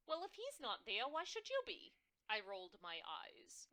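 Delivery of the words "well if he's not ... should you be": high, loud, scandalized New York mom; outraged on her daughter's behalf; indignant New York mother. Acted. Mother complaining about the boss, loud and shrill. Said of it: "My mother's shrill, New York-tinged voice".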